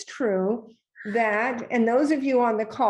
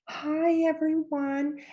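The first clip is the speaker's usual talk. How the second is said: higher, softer, drawn-out sing-song meeting voice; PTA mom voice; 4 semitones higher, 3 dB quieter, slower than usual. Retold or imitated. Mocking PTA moms addressing a meeting, weird put-on voice. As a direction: put on a syrupy kindergarten-style PTA voice. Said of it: "I called it the P T A voice".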